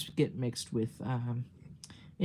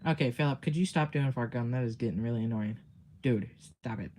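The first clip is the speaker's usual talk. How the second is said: ordinary delivery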